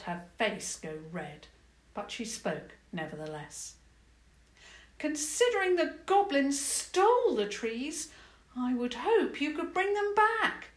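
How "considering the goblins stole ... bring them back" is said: princess sighing. Acted. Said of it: "she sighed".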